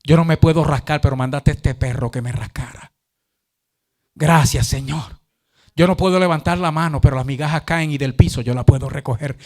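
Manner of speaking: lightly voiced beggar, pitched low; low-pitched acted prayer of thanks; grateful beggar praying. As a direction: give thanks to God as the humble beggar. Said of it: "Decía".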